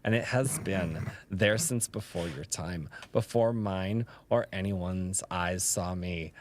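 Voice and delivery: slow, low, dramatic poetry reading. Pretend. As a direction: linger on every word like a lounge poet.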